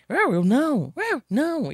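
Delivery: high, playful voicing of the dog; chirpy pretend dog talking back; high silly voice of a dog; dog's voice. Owner scolds, voicing the dog.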